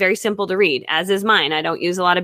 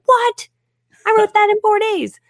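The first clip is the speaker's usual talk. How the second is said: much higher, louder astonished what; stunned disbelief; 12 semitones higher, 4 dB louder than usual. Retold or imitated. Author recalls learning her book became a bestseller, astonished. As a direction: gasp it out in shocked surprise, high and loud.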